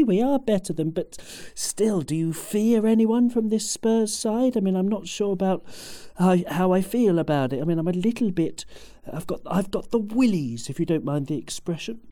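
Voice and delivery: ordinary talk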